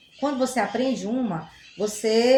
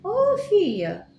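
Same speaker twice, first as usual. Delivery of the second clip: teacher imitating a local greeting, playful; playful Goiás greeting; 7 semitones higher than usual. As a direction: call out to a girl the warm local way. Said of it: "eles pronunciam fille".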